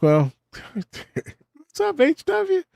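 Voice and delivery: high, slow mock voice of the daughter; teasing daughter impression; imitating daughter. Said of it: "She was like".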